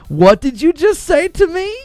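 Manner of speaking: high, loud indignant squawk; hammed-up offended yell; mock outrage. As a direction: play it like you can't believe the insult. Outraged reply to my guy.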